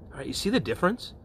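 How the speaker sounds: normal talking voice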